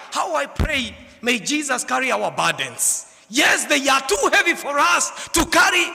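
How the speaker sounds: high, loud, shouted preaching; fervent preaching. Acted. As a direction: preach it to the rafters. Preaching at full force.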